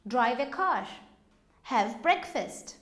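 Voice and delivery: normal talking voice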